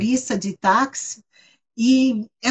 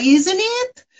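Canadian sing-song lilt, pitched higher; sotaque canadense cantado; 7 semitones higher than usual. Retold or imitated. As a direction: imitate a sing-song Canadian, lifting the end. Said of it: "ele fala assim, meio cantado". (sotaque canadense meio cantado, subindo no fim)

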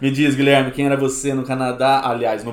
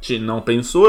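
usual speaking voice

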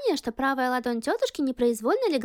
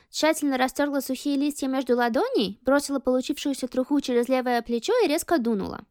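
usual speaking voice

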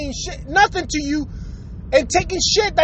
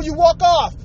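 usual speaking voice

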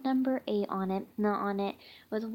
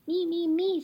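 put-on higher, eager kid calling out; 8 semitones higher than usual. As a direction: call out eagerly to go first. Kid eager to ask first.

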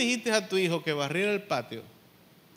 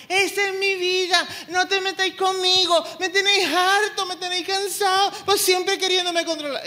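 put-on much higher, louder whiny teen voice; mocking sulky teenager; 11 semitones higher, 6 dB louder than usual. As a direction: parody the fed-up teenager talking back. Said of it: "¿Qué es lo que va a decir el joven?".

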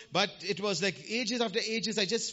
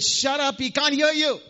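retold shout; 7 semitones higher, 6 dB louder than usual. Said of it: "these people said".

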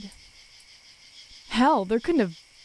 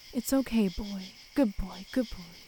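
soothing; 3 dB quieter than usual. A narrator's reading. Comforting the dying dog.

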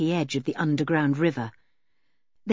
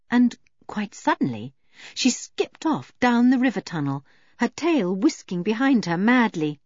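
ordinary delivery

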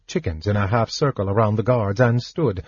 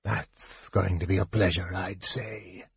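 softer, leering guard voice; hungry leer; 3 semitones lower, 10 dB quieter than usual. A narrator's reading. Guard eyes Gold Moon, soft leering murmur. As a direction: savour it quietly, leering. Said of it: "fastened onto Gold Moon hungrily".

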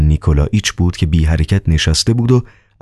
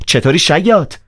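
high, harsh and scornful; rough loud greeting; 12 semitones higher than usual. Acted. Ivan rudely greets the doctor, high and harsh. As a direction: spit it at him rudely. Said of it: "بلند و با خشونت گفت".

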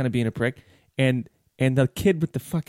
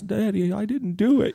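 put-on higher-pitched child swearing innocence to a parent; mock innocent child's plea; pleading kid; 7 semitones higher than usual. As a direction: play a kid protesting to Daddy. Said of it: "your kid at school who ... swears".

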